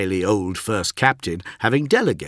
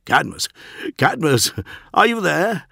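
high, urgent call with manic glee; desperate gleeful anarchy; 8 semitones higher than usual. A narrator's reading. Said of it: "part desperation, part gleeful anarchy".

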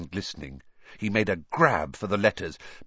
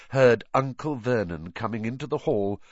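ordinary delivery, nothing marked

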